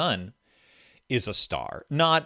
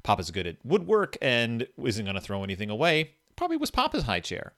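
normal talking voice

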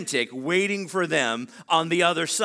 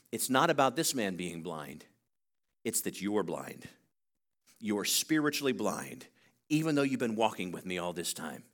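low, soft voice of a teacher confronting his followers; hushed, grave; 10 semitones lower, 6 dB quieter than usual. Retold or imitated. Preacher voicing Jesus to his blind disciples, hushed and grave. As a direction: drop right down, quiet and weighty, with pauses between phrases.

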